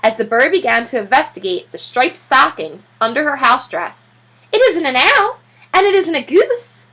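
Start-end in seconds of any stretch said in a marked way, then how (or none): 4.5-6.9 high, loud flustered lady voice; high startled matron; fussy neighbor lady. Acted. Mrs Calhoun voiced, high.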